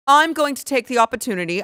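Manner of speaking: fancy English accent, mocking; put-on English accent. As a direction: play it self-important in an English accent. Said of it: "an English accent".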